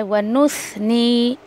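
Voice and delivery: वनुस्नी slow, nun blended into the sa; वनुस्नी drawn out with a clear s; slow tajweed demo. Shown as a demonstration. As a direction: glide वनुस्नी with nun merged into s. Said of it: "नून को सा में मिलाए".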